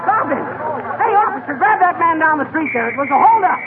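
high yelling for help; shouting. Acted. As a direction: shout after the thief. Chasing robber, calling officer.

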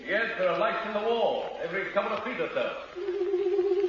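high, softened voice from below; far-off, high-pitched reply; distant call back. Acted. Answering from down the stairs.

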